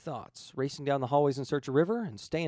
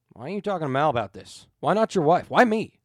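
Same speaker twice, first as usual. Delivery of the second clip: louder, rising, exasperated; indignant protest; 3 semitones higher, 10 dB louder than usual. Acted. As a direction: bristle and push back hard. Jayne protests loudly.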